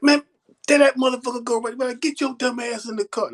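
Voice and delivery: 답답해서 높은 목소리로 외침; exasperated call. Retold or imitated. Yelling at drunk friend.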